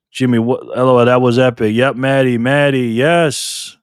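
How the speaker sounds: loud, thrilled cheering; excited cheering. Real, not acted. Host cheers Maddie's win to chat, loud and thrilled. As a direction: celebrate the win at full volume.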